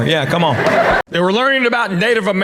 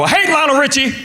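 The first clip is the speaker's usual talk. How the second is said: mock spouse outburst; 8 semitones higher than usual. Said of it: "one of you's gonna go".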